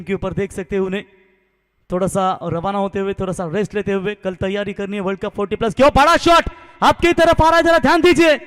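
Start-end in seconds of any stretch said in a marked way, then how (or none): 5.7-8.4 loud, high warning then six call; excited cricket commentary. Real, not acted. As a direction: call the big hit at full voice. Warning crowd, then six called.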